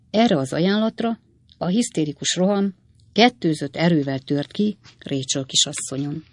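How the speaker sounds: ordinary delivery, nothing marked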